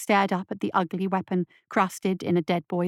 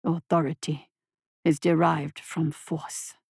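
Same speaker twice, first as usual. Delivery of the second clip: monster voice; 5 semitones lower than usual. A narrator's reading. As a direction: purr approval like a monster. Monster lectures boy.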